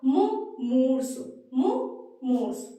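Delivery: मुंह मुरसू spoken slowly, every syllable drawn out; slow careful pronunciation. Shown as a demonstration. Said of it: "तो सही क्या होगा?".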